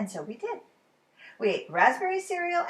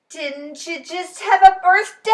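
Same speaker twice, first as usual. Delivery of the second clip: Ted's loud question; 7 semitones higher, 11 dB louder than usual. A narrator's reading. Ted convincing the boy.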